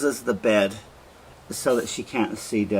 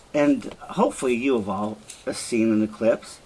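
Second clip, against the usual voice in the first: ordinary talk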